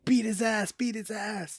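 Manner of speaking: high mock yell of an angry girlfriend; retold scream. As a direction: shout it like she wants a fight. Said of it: "His girlfriend starts screaming".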